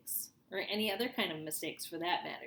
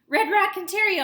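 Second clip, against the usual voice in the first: very high, very loud scream; 13 semitones higher, 17 dB louder than usual. A narrator's reading. Kid screaming the last answer into the phone. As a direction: shout it with everything. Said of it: "I screamed into the phone".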